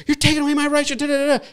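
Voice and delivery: high-pitched mock outrage; mock angry protester. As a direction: parody someone shouting about their rights.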